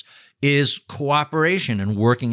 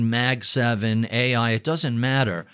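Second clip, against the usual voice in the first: ordinary delivery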